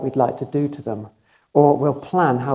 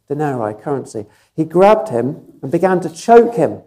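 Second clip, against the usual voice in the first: normal talking voice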